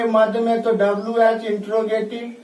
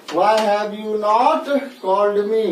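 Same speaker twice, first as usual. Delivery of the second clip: drawn-out model phrase; much slower than usual. Shown as a demonstration. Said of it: "drawn-out English model".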